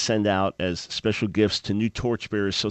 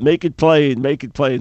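louder call-out; mock yell; 4 semitones higher, 6 dB louder than usual. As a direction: chant it like an amen. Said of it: "We'd yell out".

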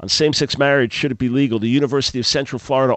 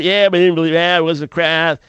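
mean-spirited commenter; 4 semitones higher, 5 dB louder than usual. Retold or imitated. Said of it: "But then you get the mean spirit".

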